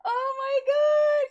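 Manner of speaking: high, loud and slow oh my god mid-laugh; laughing shriek. Real, not acted. Streamer cracking up at Kiki, shrieking with laughter. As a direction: wail it out through laughter.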